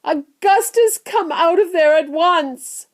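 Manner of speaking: high, loud motherly command; frantic, high-pitched order; yelling. A narrator's reading. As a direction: yell up at your stuck son. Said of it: "yelled Missus Gloop".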